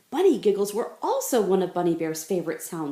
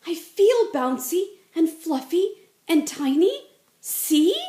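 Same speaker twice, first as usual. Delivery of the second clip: much higher, louder pleading; eager gasp; 10 semitones higher, 7 dB louder than usual. Acted. Bunny Bear eagerly insisting he is a bunny. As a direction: bounce with eager hope. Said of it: "Bunny Bear gasped".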